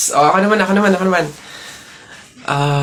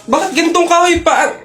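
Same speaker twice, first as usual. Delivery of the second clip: whiny complaint; 9 semitones higher than usual. Acted. Complaining about big stick.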